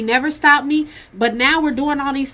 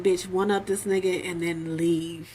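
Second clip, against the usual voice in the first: low, soft, slow self-talk; quiet scheming thought; low slow inner voice plotting; inner-voice mutter; 5 semitones lower, 6 dB quieter than usual. Retold or imitated.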